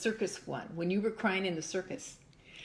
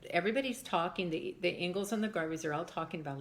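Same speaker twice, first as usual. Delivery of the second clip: ordinary talk, nothing marked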